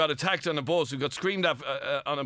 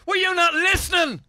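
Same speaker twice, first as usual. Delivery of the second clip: high, loud outraged cry; host shouting at a caller who missed the point, high and loud; exasperated shout; 13 semitones higher, 6 dB louder, slower than usual. Real, not acted. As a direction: yell in disbelief at the caller.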